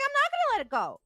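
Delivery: wife refusing her husband's calm, in a high outraged yell; mock outraged shout. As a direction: retell your fury at full pitch. Retold outrage at rude cast member.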